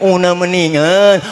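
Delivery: exaggerated nasal garble on "una meninggal"; mock blocked-nose speaker; nasal speech impediment. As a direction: repeat "una meninggal" through the nose, consonants mushed. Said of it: "Yang bindeng aja nggak boleh".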